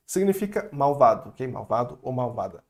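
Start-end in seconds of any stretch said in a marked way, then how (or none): none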